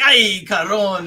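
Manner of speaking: lightly acted Spanish call-out; imitated movie call-out. Acting out a movie catcall.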